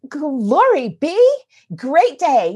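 drawn-out, delighted exclamation; slow, gushing retold delight; mock delight. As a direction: savor it with exaggerated, slow gratitude. Delighted reaction to courteous driver, retold.

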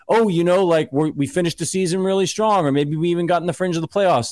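mock consoling team. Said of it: "like a Detroit Lions who are like".

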